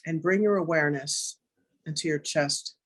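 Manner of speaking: calm, unhurried meditation guide voice; guided meditation. Acted. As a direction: ease the listeners into stillness, gently.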